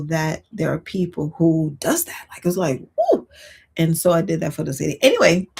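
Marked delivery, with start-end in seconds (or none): 3.0-3.3 a sudden high delighted woo. Retold or imitated. Remembering the neighbor's kindness, a high delighted woo. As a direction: let out a happy little whoop.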